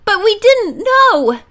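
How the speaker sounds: high, slow, pleading protest. A narrator's reading. Bess protesting to the angry driver, high and pleading. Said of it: "Bess protested".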